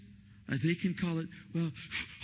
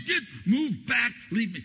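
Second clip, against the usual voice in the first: higher, louder mock yell; wife's yell; 8 semitones higher, 4 dB louder than usual. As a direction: push him away, mock shouting. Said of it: "she goes".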